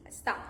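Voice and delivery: very soft está, accent on "tá"; quiet está leaning on its final syllable; stress on last syllable. Shown as a demonstration. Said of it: "Because has this accent".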